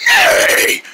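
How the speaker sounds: high shrieking neigh; horse whinny. Acted. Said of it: "did that sound like me?".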